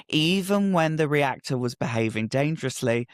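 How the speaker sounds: ordinary talk, nothing marked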